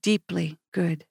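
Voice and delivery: a hint of British on "Deeply good"; friend's British accent, lightly imitated and soft. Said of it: "in her British accent".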